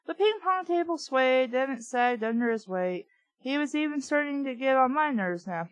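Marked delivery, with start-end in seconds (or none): none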